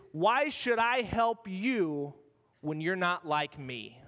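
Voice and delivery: slow, deliberate challenge, every word weighed; slow pointed question. Acted. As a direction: lay the question out slowly, like a test. Preacher voices Jesus' challenge.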